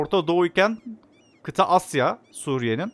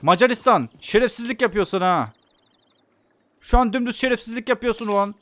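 angry scolding; 7 semitones higher, 3 dB louder than usual. Real, not acted. Scolding Hungary in game.

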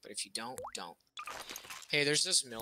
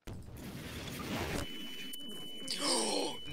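dramatic gasp; 9 semitones higher, 5 dB quieter than usual. Real, not acted. Reacts to friend's trick.

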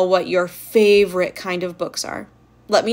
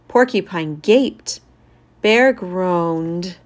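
ordinary delivery

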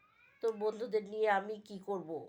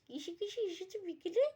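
put-on much higher girly voice; girlfriends' voice; 10 semitones higher than usual. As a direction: play the curious girlfriends. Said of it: "ডার্লিংরা বলছে". (মেয়েলি উঁচু গলায় প্রশ্ন)

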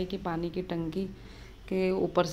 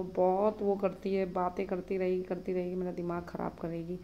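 ordinary delivery

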